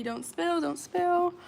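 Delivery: ordinary talk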